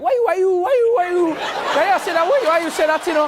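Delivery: loud, very high exaggerated Haitian chatter; hammed-up, barely intelligible Haitian friend; thick Haitian accent. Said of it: "I can't understand a word that they're saying".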